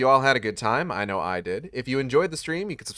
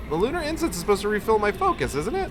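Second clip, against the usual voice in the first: gabbled fast, climbing higher into outrage; rapid, rising disbelief; 8 semitones higher, faster than usual. Real, not acted. Streamer at the game, fast and higher in disbelief. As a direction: rattle it off fast, voice shooting up.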